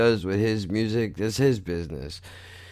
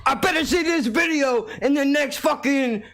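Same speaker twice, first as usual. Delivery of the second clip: much higher cocky rapper persona; mumble rapper voice. Acted. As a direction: play an obnoxious mumble rapper. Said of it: "So I'm portraying a mumble rapper".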